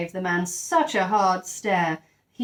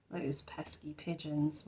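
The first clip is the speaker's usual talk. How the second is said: usual speaking voice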